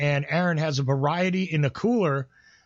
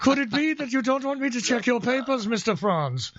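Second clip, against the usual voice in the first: higher, laughing mock officer voice demanding answers; mock interrogator; 7 semitones higher than usual. Teasing a friend about his German roots, hammed-up interrogator voice. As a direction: play a hammed-up suspicious border officer, grinning through it.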